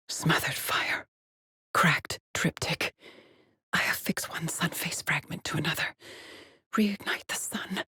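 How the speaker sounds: hushed, unhurried whisper; ritual whisper. Acted. Explorer joining sun face fragments, whispering reverently. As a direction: whisper it like an incantation.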